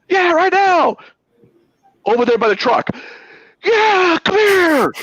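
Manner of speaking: high mock tough-guy threat; threatening guy. Retold driver threatening him, high mock menace. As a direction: ham up the bully. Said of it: "He goes".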